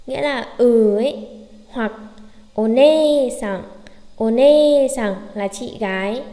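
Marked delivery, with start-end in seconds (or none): 2.5-5.1 "one-san" slow with the "ne" stretched; long e demo. Shown as a demonstration. Teacher demonstrates the word for elder sister, slow and stretched. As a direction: draw out "one-san" slowly. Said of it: "chúng ta cũng sẽ đọc E và nê".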